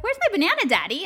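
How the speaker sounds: put-on high whiny little kid asking for his banana; child's whine. Little son whining to his dad for his banana. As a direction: pitch up into a small boy's plea.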